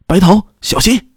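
high, urgent cry of warning; alarmed warning shout. A narrator's reading. Fighter warning a comrade who was hit, alarmed shout. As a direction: cry out in alarm, pitched well up.

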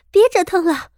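high, loud, flustered outburst; exasperated angry shout. A narrator's reading. Soaked princess to the girl clutching her in the lake, exasperated shout. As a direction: shout it out, fed up and furious. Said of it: "气急败坏地大声道".

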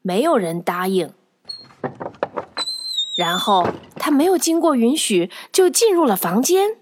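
ordinary delivery, nothing marked

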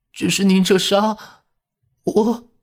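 put-on high girl's voice breaking into tears; starting to cry. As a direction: let the girl's voice crumble into crying. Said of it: "她开始哭了起来".

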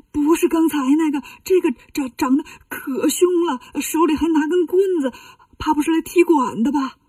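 stammering, hammed-up scared report; frightened monk. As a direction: stumble over your words in alarm. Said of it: "吓得扭头就跑". (吓得结结巴巴地报告)

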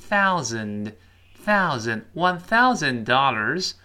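slow "thousand" with the tongue-out "th"; drawn-out, careful "th" in "thousand"; clear TH demo. Shown as a demonstration. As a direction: model "thousand" slowly, th made at the teeth. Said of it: "T H别忘记一定要吐舌头".

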